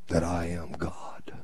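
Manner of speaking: slow whispered declaration; hushed whisper. Acted. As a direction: drop to a slow, reverent whisper. Hushed after commanding the storm.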